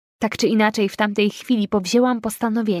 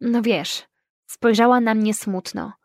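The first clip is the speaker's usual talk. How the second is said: plain reading voice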